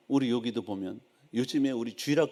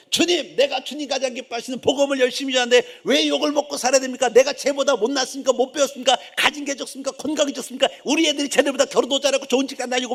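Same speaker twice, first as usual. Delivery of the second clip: high, loud mock protest to God; hammed-up aggrieved outburst, pitched high throughout; indignant complaint; 10 semitones higher, 5 dB louder than usual. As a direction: imitate a wronged believer ranting. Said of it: "막 소리 지르고 막".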